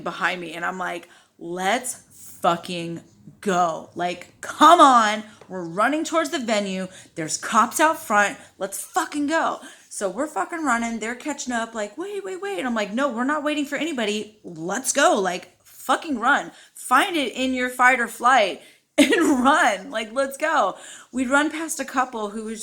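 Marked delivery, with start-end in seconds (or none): 1.4-9.6 loud retold rallying cry, punching each word; urgent calling out. Calling to friends trailing behind, retold. 12.0-12.6 high, quick mock plea; imitated pleading call. Imitating friends calling to catch up. 12.9-20.8 raised, forceful retold urging; urgent insistence. Retold refusal to wait while fleeing.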